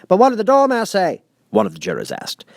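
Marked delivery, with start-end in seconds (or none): 0.1-1.2 juror. A narrator's reading. Juror voiced in story.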